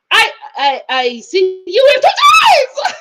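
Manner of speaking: mock French accent climbing high and loud; exaggerated French accent with a stammered start; [name]. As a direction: force out strained French-accented words, ending in a squeal. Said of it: "she's, like, rushing to get them out of her body".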